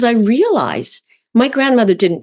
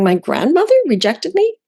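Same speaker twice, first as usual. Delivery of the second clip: shocked disbelief; 9 semitones higher than usual. Retold or imitated. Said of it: "I'm going".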